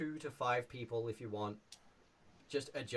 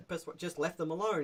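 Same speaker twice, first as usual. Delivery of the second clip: normal talking voice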